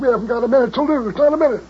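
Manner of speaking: 又高又快地催促; 急得声音越拔越高. Acted. Rushing to the station.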